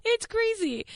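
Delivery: high squeal through laughter; real laughing. Real, not acted. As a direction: crack up in disbelief while talking. Laughing at own dating life.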